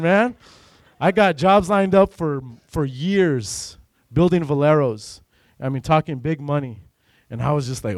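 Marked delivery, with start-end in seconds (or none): none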